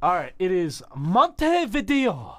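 hammed-up Spanish accent, high and slow; mock Spanish accent, drawn out and loud. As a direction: put on a showy Spanish accent. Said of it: "good old accent of the Spanish people".

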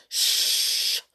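long, voiceless shush; hushing. Acted. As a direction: shush them urgently. Everyone hushes the bear.

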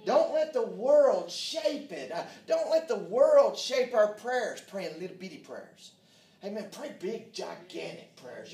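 loud, high-pitched exhortation to the congregation; full-force preaching. Real, not acted. Preacher warning the church about the world, loud, fervent preaching. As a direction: preach it hard, hitting key words.

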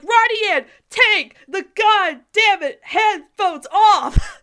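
high, loud, slow yell at a cartoon; yelling at the screen. Friends yelling at Roddy Ann to take off her headphones, loud and high. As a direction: shout it like she can't hear you. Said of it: "we're all like".

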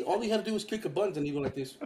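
normal talking voice

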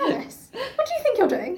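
high, laughing mock scolding; scolding animal voice. Animal scolding Alice, voiced high through a laugh.

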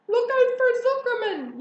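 very high, loud alarm call; yelling. A narrator's reading. Gander warning pig of farmer, yelling. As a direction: yell a warning at full voice. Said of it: "yelled the gander".

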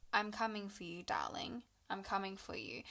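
hushed, threatening whisper; menacing whisper. Retold or imitated. A voice haunting the wife's memory, menacing whisper. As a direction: whisper it like a stalker. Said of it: "remembering someone saying".

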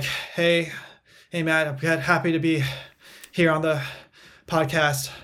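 gasping for air between every few words; catching breath. Shown as a demonstration. Runner greeting podcast host mid-run, out of breath. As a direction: break it up with puffs, like a winded runner. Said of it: "trying to catch my breath between every word".

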